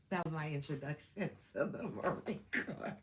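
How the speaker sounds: words broken up by laughter; laughing. Real, not acted. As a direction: giggle helplessly through the sentence. Laughs at own retold introduction.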